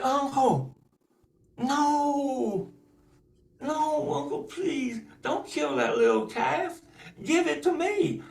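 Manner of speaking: high pleading little voice; begging girl. Acted. Niece begging her uncle to spare the calf, high pleading. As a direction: plead for the calf's life.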